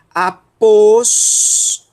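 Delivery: drawn-out, emphatic Após; syllable-by-syllable demo. Shown as a demonstration. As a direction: sound out Após with a strong second syllable. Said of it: "nós vamos pronunciar".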